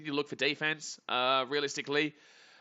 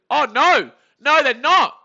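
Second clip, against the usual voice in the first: loud, high shocked no; surprised outcry; 8 semitones higher, 13 dB louder, slower than usual. Real, not acted. Fan shocked by the baserunning, loud and high. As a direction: react in disbelief. Reacting to play.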